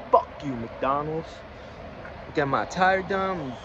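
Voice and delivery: usual speaking voice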